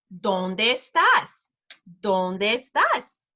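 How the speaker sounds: very slow, drawn-out teaching phrase; slow repeat-after-me demo; slow modelling. Shown as a demonstration. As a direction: stretch each syllable out for learners to copy. Teacher models new phrase slowly.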